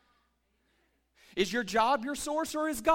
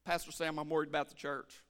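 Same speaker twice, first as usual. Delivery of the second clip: mock worrier; 9 semitones lower, 7 dB quieter than usual. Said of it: "We act like God don't have it together".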